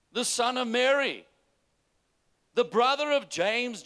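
high, loud preacher's cry; preaching at full force. Acted. Pastor preaching to his church, loud and high.

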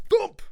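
high, loud spoken crash effect; loud thump. A narrator's reading. Heroes landing on a table of books, loud thump. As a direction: punch it out as a sudden impact.

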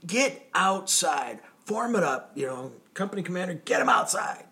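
high, shouted drill sergeant orders; mock drill instructor yell. Drill instructor ordering the company outside, high mock yell. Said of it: "and he's like".

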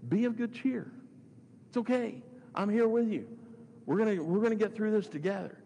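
high-pitched, urgent encouragement; earnest reassurance. Retold or imitated. Preacher voices Jesus telling Paul he's here, high earnest reassurance. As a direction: lift the voice high to hearten someone.

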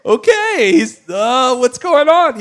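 loud, high-pitched mock panic; flustered. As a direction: blurt it out in bewilderment. Flustered reaction to the wailing.

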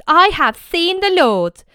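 Mary telling the disciples the news; joyful announcement. Acted. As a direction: proclaim it with wonder.

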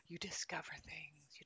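breathy gasp into a whisper. Acted. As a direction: hush it like a secret found. Wonder at discovery.

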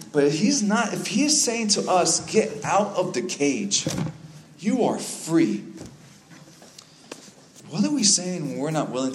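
high, loud preaching slowing to weighty words; emphatic preaching. Real, not acted. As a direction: proclaim it forcefully, stretching out the final command.

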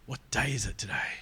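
conspiratorial whisper, breath only. Retold or imitated. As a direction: lean in and whisper it like a secret. Jesus secretly asks Simon.

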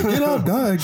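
high-pitched dog voice pleading, falling. Pretend. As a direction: voice the pet dog nudging its owner awake. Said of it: "Grace would be, like, licking my face".

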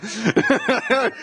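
laughing through the words as he starts the story; giggling, can barely get it out. Real, not acted. Preacher laughing while telling the church about an old pastor.

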